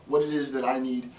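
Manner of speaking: ordinary talk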